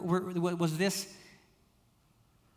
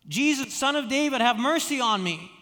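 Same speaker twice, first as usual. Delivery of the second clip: calling out; 6 semitones higher, 6 dB louder than usual. Retold or imitated. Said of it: "he called out".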